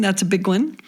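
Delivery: ordinary delivery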